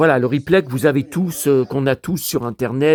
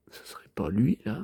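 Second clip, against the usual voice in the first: low whispered wondering; soft unvoiced guess; 5 semitones lower, 9 dB quieter than usual. Real, not acted. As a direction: whisper it, half to yourself. Musing aside.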